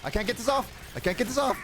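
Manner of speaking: high panicked call for a medic; man down shout. Real, not acted. Grabbed by parasite, calls medic.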